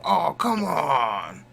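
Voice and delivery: slow, drawn-out "Oh, come on!" cry; mock game yell. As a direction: ham up the video game player's complaint. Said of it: "they would yell".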